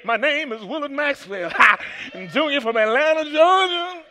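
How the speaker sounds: high, sung preacher hoop. Pretend. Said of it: "I should just hoop my name".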